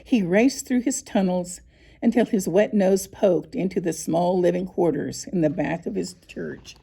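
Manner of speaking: ordinary delivery, nothing marked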